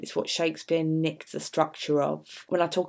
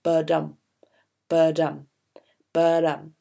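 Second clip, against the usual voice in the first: thump out "ba-dum" like a heartbeat; heartbeat rhythm; 5 dB louder than usual. Shown as a demonstration. Teacher showing the heartbeat rhythm of iambic pentameter. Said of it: "it goes".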